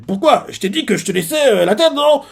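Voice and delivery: fast, rising to a high innocent whine; smug rival feigning innocence; mock innocence. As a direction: play dumb and needle him. Katsuro's mock innocence again.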